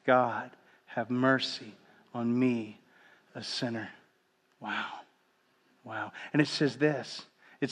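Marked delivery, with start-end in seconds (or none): 0.1-3.9 much softer and lower, slow, fading on each phrase; breath prayer. Shown as a demonstration. Preacher demonstrating the ancient breath prayer softly. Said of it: "Say it again". 4.6-6.1 hushed awe. Real, not acted. Hushed awe after the prayer.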